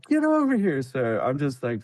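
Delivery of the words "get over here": retold yell. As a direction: bark it like a sergeant under fire. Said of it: "he's like".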